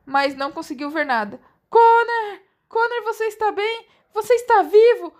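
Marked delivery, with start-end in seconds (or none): 1.7-5.1 high, loud frantic calling; panicked, calling over the wall; hysterical. A narrator's reading. Sister fearing her brother is hurt, high frantic calls. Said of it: "perguntou ela histericamente".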